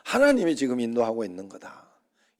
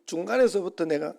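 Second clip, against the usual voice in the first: usual speaking voice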